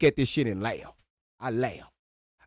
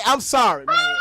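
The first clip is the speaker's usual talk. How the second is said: cracking up while sorry; laughing apology; 10 semitones higher than usual. Real, not acted.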